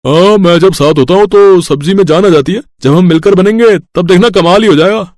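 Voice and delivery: cartoon tomato bragging that it brings the dish to life; boastful cartoon vegetable. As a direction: pump yourself up like a tiny vegetable hero. Cheerful vegetable boasts in the kitchen.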